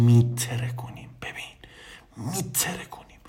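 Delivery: breathy hushed whisper, barely voiced; conspiratorial whisper; secretive whisper. Real, not acted.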